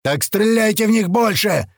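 high, exasperated shout back; yelled retort. A narrator's reading. Грач answering a panicked mate, yelling. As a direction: yell the answer straight back. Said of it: "орёт ему в ответ Грач".

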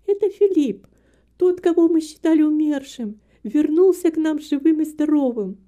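high, loud woman's voice announcing news; joyful proclamation, pitched well up; excited exclamation. A narrator's reading. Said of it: "воскликнула Эмилия". (восторженное восклицание дочери, высоко и громко)

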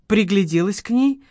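plain reading voice

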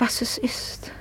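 breathy whisper, barely voiced. Lady whispers her unease to José. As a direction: drop to a helpless whisper.

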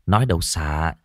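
plain reading voice

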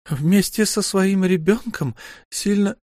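light female character voice; Lyu's voice. A narrator's reading. Lyu speaks.

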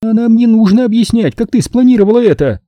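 high, loud scornful cut-off; contemptuous. A narrator's reading. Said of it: "презрительно оборвала её Нина". (презрительно, высоко и громко)

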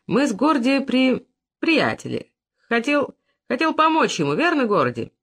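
put-on stumbling drunk, words dragged then restarted; drunken explanation. Drunk man explaining to the waiter, tipsy stumbling.

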